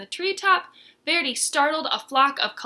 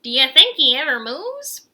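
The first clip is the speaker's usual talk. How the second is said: put-on young snake teasing; 3 semitones higher, 10 dB louder than usual. Young snake mocks Verdi.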